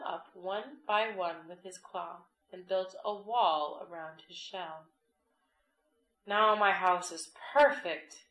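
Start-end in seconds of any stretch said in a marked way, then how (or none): 6.3-8.3 loud, happy cheer. A narrator's reading. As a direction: cheer it out proudly. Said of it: "cheered Hermit Crab".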